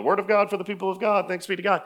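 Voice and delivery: mock-routine church reading sign-off; rote liturgical response. Mimics closing the scripture reading.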